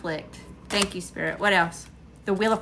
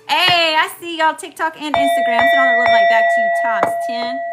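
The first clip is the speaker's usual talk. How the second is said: big, high, loud call to the viewers; loud shout-out; 4 semitones higher, 7 dB louder than usual. Real, not acted. Reader greets TikTok and Instagram viewers, loud shout-out. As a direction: send it out loud and drawn big.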